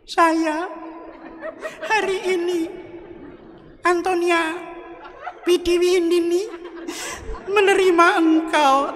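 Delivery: high, teary, half-laughing mock sob; tearful bride. Bride saying her wedding vow, mock crying through it. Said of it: "karena istrinya nangis".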